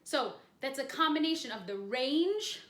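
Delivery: ordinary talk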